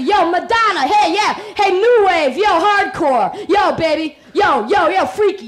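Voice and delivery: loud street calls; catcalling. Acted. Men on the street catcall a woman, loud hollering. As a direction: holler at her across the street.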